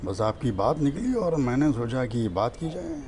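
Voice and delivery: lightly voiced Naseeruddin Shah thanking a caller. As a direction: play the gracious veteran actor, softly. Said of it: "Naseeruddin Shah साहब के साथ बैठा हूँ".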